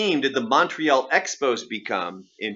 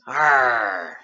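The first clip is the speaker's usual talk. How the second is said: growl. Acted. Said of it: "what, what is this?".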